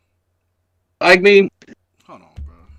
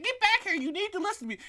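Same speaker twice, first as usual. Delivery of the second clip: high, softer falsetto command; mock grandma scolding; 13 semitones higher, 10 dB quieter than usual. Friend acts out grandma as boy storms off, mock grandma voice. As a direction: imitate a grandmother calling the boy back, high-pitched. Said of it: "She's like".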